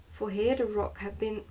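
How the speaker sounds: plain reading voice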